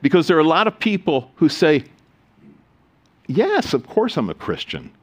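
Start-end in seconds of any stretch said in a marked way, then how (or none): none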